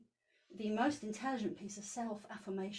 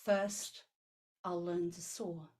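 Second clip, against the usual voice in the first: poem reading; 2 semitones lower than usual. Acted.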